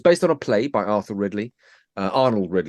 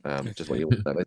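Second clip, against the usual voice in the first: normal talking voice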